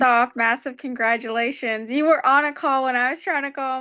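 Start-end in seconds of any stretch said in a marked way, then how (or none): none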